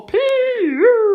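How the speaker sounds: high, playful yuck at a stink; disgusted pee-oo. Acted. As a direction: wrinkle your nose and squeal it out. Stinky smell exclamation.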